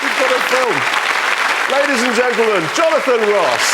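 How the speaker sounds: high, loud show host voice; announcer. Acted. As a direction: sign off like a TV host. Mock show sign-off.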